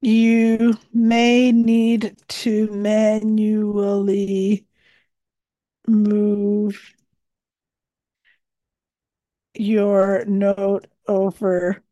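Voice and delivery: slow, measured dictation voice; dictating. Acted. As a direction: dictate each phrase carefully. Dictating tip into the note.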